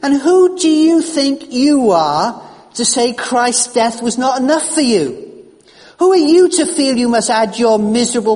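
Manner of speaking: a doctor rebuking his guilty patient, loud and indignant; growing anger. Retold or imitated. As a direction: pour out the anger, building. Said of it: "I found my anger increasing".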